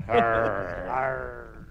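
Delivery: deep pirate Arr; low pirate growl. Pretend. Laughing after pirate joke.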